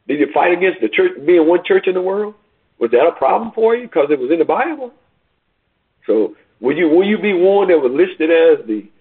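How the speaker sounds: high, rising preacher's call, building in force; impassioned preaching. Acted. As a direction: press each question on the congregation like a revival preacher.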